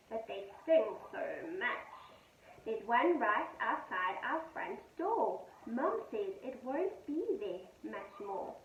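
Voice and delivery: gentle sing-song poem reading; poem read to children. A narrator's reading. Teacher reading a poem to her pupils, gentle and sing-song.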